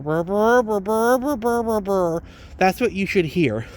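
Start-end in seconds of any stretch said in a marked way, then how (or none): 0.0-2.2 rising muffled trombone babble; Charlie Brown adult noise. Retold or imitated. Ranter mocks pro-lifers as Charlie Brown adults, muffled babble. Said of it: "all the adults were like".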